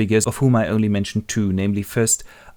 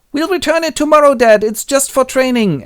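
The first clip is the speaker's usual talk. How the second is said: put-on very high, loud boy voice; acted kids; 6 dB louder than usual. Boys explain stolen footballs to Dad, childlike. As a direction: pipe up like a boy excusing himself. Kids' voices in Willie's memory.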